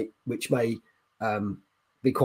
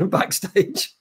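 slow, high and louder through real laughter; laughing; 8 semitones higher, 5 dB louder, slower than usual. Real, not acted. Streamer laughing about co-host Dave's reaction, laughing. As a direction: laugh it out at your mate's sulky face.

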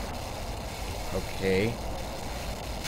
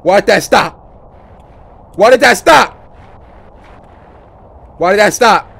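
loud, repeated demand; alarmed shout; 8 dB louder than usual. Real, not acted. Player startled as something stops in the game, alarmed shout. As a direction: raise your voice in alarm.

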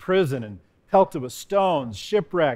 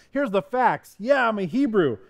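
higher-pitched retold boast; 6 semitones higher than usual. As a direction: lay out credentials with mild pride. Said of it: "he's boasting, but he's also telling him".

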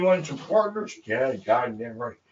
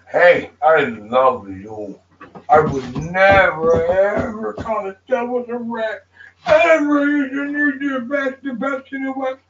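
tearful plea; 7 semitones higher, 5 dB louder than usual. Real, not acted. Streamer swears loyalty to the gang, sobbing and pleading. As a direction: voice breaking into sobs, high and loud. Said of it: "Don't cry, bud".

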